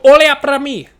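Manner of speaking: high, loud Portuguese call-out; grandmother yelling. Retold or imitated. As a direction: holler it across the store like a proud grandma. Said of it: "She would yell".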